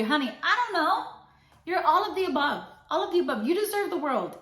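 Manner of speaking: hype-up. Real, not acted. Hyping up listeners.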